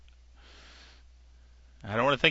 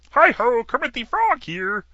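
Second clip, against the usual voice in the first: a cheerful frog puppet hello, pitched up high; Kermit the Frog impression; 9 semitones higher than usual. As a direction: frog puppet greeting the audience. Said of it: "I just can't do it the way I used to".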